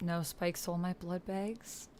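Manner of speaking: ordinary talk, nothing marked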